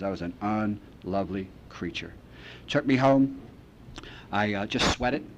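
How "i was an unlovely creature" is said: dropped low and soft, heavy; quiet confession. Real, not acted. Recovering drunk tells the room, low and soft. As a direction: let the words sink, ashamed.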